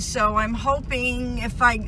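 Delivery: usual speaking voice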